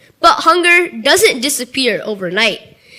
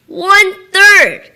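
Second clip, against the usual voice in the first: One-third! punched higher and louder, slow; emphatic exclamation; 7 semitones higher, 7 dB louder, slower than usual. Real, not acted. Emphatic repeat of statistic.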